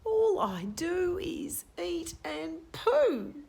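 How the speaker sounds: drawn-out grumble of a bored bird; Cobber's thought. Acted. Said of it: "Cobber thought".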